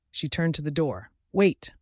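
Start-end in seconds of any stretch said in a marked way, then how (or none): 1.3-1.7 high, loud call; crying out. A narrator's reading. Mother calls her daughter back before she flees, loud cry. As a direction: stop her from leaving. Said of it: "her mother cried".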